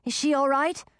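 high, worried question; mild alarm, pitch lifted; anxious. A narrator's reading. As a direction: ask anxiously after someone dear. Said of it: "Audun asked anxiously".

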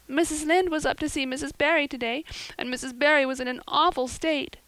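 put-on high, mild girlish wail; a heartbroken girl wailing her news; wailing Anne. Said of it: "she wailed".

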